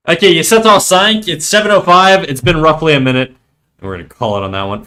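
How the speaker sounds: usual speaking voice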